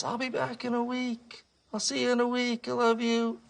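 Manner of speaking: high, sing-out voice of a departing wife; Goldie's farewell. Acted. Goldie calls to Art as the boat leaves, high farewell. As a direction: pitch it high, calling a loving goodbye. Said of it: "Goldie blows him a kiss".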